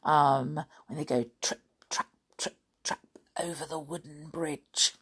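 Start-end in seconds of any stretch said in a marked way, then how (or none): 1.4-4.9 very soft, near-whispered hoofsteps; quiet imitation of trotting goats; hushed storytelling. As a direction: tiptoe through it softly. Mimics goats crossing bridge.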